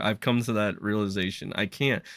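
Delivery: ordinary talk, nothing marked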